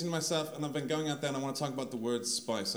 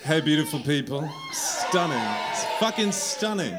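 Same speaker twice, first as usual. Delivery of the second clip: loud greeting; 7 dB louder than usual. Real, not acted. Greeting crowd loudly on stage.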